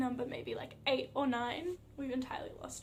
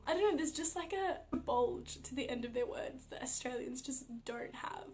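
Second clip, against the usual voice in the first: normal talking voice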